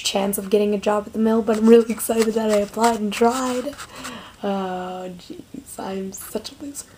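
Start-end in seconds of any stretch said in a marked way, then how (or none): 4.4-6.9 soft embarrassed whisper. Real, not acted. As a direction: whisper it under your breath. Whispering while others nearby.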